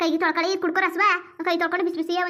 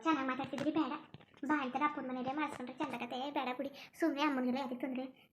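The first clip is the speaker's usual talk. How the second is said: soft, low-pitched demurral; quiet refusal; 4 semitones lower, 14 dB quieter than usual. Acted. Wife declining to trouble his mother, quiet refusal. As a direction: turn the offer down very softly.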